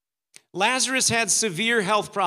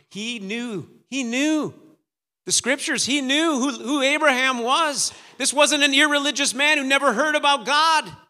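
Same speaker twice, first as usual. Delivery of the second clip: higher, louder, hammering each point; forceful preaching; 6 semitones higher, 6 dB louder than usual. Real, not acted. Preacher insisting the rich man knew the scriptures, forceful preaching. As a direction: build to an emphatic preacher's peak.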